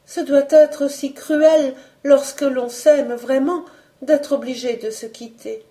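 put-on high, sorrowful young woman's voice; wistful, near tears. A narrator's reading. Young woman all but confessing her love, tearfully wistful. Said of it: "en refoulant ses larmes".

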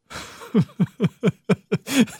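real staccato belly laugh; long rolling chuckle; hearty laugh. Real, not acted. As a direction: burst out laughing at the punchline. Punchline of the doctors anecdote.

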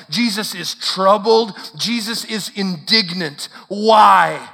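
high, loud, hammering sermon cadence; impassioned preaching. Real, not acted. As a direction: build insistent repeated statements into forceful questions. Preaches at full force.

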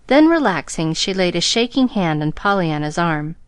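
plain reading voice

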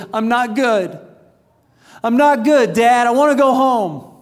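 high, loud mock wail of a boy; imitated bawling child. Son to dad after losing the game, imitated tearful outburst. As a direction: cry out as an upset little son, without real sobs. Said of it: "He starts bawling, like, huge tears".

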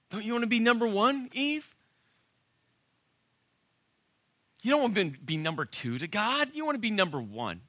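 high, loud, wheedling tempter; tempting serpent. Retold or imitated. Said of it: "Guess what the serpent was saying?".

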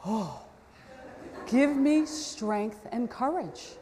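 slow, put-upon plea with a heavy sigh; praying for patience. Retold or imitated. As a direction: beg heaven for patience, slowly. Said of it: "And I just like".

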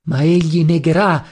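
usual speaking voice

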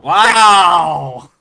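loud shocked wow; surprised shout. Real, not acted. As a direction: burst out in sudden surprise. Co-host swears twice on air.

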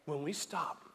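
soft-spoken communion meditation; hushed meditative preaching. Acted. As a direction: draw them quietly into the scene. Hushed communion meditation over music.

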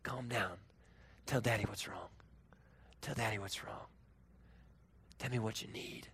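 low, hushed comforting voice; softly calm a sobbing child; soothing father. Retold or imitated. Father holding crying kids close, soothing softly. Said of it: "I hold 'em close".

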